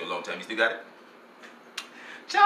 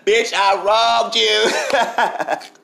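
slow, drawn-out higher sass; sassy retort; 7 semitones higher, 5 dB louder, much slower than usual. Retold or imitated. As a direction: stretch every word out to taunt her. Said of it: "I said".

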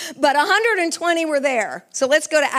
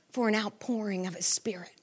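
whisper; 5 semitones lower, 12 dB quieter than usual. Real, not acted. Whispered after crying out.

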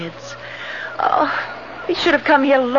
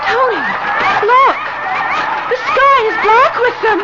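alarmed cry; 9 semitones higher than usual. Acted. Wife warning her husband of the birds, alarmed. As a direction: cry out at the sight of the swarm. Birds swarm toward the couple.

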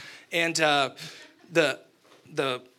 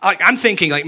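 usual speaking voice

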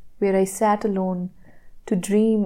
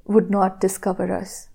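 ordinary delivery

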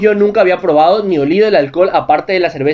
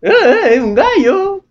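squeaky high exclaim; 11 semitones higher, much slower than usual. Retold or imitated. Retelling her own squeal of certainty at the party. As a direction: squeal it out like a sure discovery. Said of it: "yo estaba segura".

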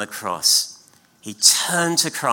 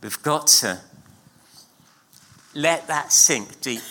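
usual speaking voice

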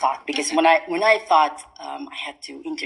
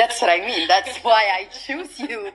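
laughing through the words, a bit higher; 5 semitones higher than usual. Real, not acted. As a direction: giggle as you explain yourself. Host laughing while explaining choice.

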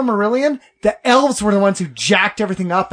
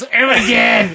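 Trolls quote. Retold or imitated. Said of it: "From Trolls".

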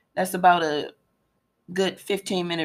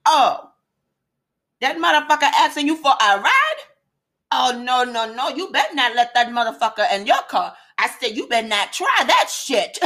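animated warning; 6 semitones higher than usual. Retold or imitated. The speaker reenacting warning the attendant, animated. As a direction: wave her off it, half laughing.

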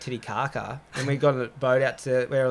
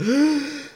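high, sharp gasp for air; surfacing gasp; 13 semitones higher, 4 dB louder than usual. Retold or imitated. Diver showing how he comes back up, gasping. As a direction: gulp air as if just surfaced. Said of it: "you get up, you're like".

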